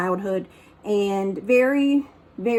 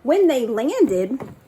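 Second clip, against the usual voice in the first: plain reading voice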